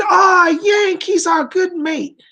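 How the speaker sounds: high, drawn-out, hearty British veteran impression; British airmen's greeting. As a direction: imitate old RAF pilots hailing a Yank. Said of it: "two gentlemen there who had been".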